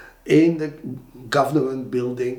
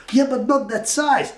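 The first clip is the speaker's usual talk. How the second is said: mock company voice; 11 semitones higher, 3 dB louder than usual. Company doubting again.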